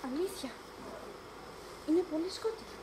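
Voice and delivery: very soft, low, frightened; hushed fear. Acted. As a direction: barely breathe it into the dark. Frightened whisper in the dark.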